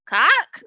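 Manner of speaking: high, loud bird screech; sharp playful Cack; pterosaur screech. Acted. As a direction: screech like a flying dinosaur. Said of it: "screeches the petrosaur".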